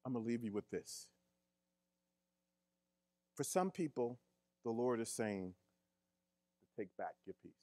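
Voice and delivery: hushed closing. Acted. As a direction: wind the sermon down gently. Quiet closing of sermon.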